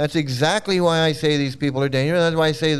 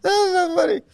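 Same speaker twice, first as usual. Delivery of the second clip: laughing; 12 semitones higher than usual. Real, not acted. Laughing after clip.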